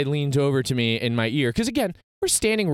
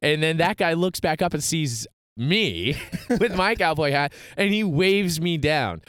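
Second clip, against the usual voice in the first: ordinary delivery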